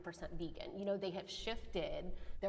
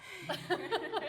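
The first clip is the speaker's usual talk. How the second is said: laughs; 12 semitones higher, 8 dB louder than usual. Real, not acted. Laughing at feeling judged.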